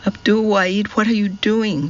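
mild shout at her nephew. A narrator's reading. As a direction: call out to him in alarm. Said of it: "shouted Jasmina".

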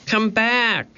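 high, drawn-out call; shouting. A narrator's reading. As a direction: call someone back in a high voice. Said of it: "Annie shouted".

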